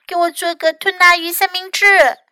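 high little-one voice; small child's voice. A narrator's reading. Hurt Louis asks Fox to help, high small voice.

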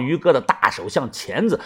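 ordinary delivery

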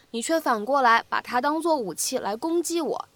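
usual speaking voice

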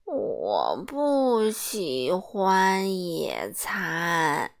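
very slow, low complaint; sulky slow protest; slow grumbling. Acted. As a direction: grumble it out reluctantly. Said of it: "他抱怨道".

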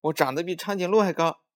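put-on high, loud, quick child's boast; boastful clamour. A narrator's reading. Songsong outbragging Maomao about growing tall, loud boasting. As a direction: outdo the other child, loud and fast. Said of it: "妈妈听见他们直嚷嚷".

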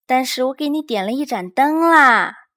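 reasoning with the cub; Big Bear voice. A narrator's reading. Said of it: "大大熊说。".

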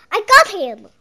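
loud, high triumphant cry; catching the imagined monster. A narrator's reading. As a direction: shout it like a victory.

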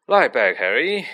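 usual speaking voice

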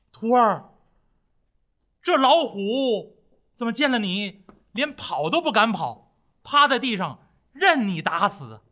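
拉慢、响亮地惊问徒弟; 唐僧难以置信地问徒弟; 惊讶追问. Acted. 唐僧惊问徒弟. (放慢提声，惊叹发问)

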